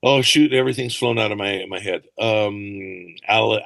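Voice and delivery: usual speaking voice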